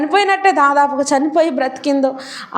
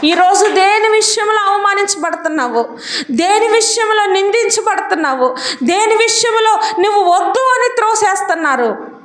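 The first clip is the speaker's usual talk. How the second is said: full-force preaching; 6 semitones higher than usual. Acted. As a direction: build each clause like a rallying cry.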